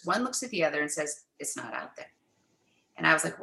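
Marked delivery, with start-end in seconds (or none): 1.4-2.1 whispered, as if sharing a secret; hushed discovery; hushed reveal. Retold or imitated. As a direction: lean in and breathe it.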